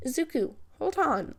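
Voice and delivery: put-on father shouting after the boy. A narrator's reading. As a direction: call out to hold him back. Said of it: "the man shouted".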